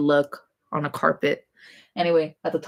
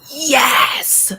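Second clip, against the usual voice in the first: loud, high, eager yes; all-in yes, a lot louder; emphatic yes; 8 semitones higher, 6 dB louder than usual. Real, not acted. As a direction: burst out with a big yes. Answering whether she wants acting.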